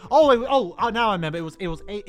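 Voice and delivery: usual speaking voice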